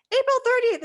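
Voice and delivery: high, loud urgent answer; urgent blurt. Retold or imitated. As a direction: fire the date at her, high. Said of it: "And I'm like".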